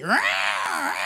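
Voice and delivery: loud mock screeching snarl; imitated scream. John and the polecat up the tree, imitated screaming. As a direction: screech like a man tangled with a polecat. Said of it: "they don't know who's screaming".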